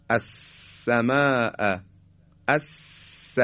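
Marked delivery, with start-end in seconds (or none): 2.5-3.4 الس with a long hissed voiceless sin; الس with a drawn-out whispered sin; continuous sin. Shown as a demonstration. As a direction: let the sin of الس hiss on without stopping. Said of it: "این سین جریان صوت ادامه داره".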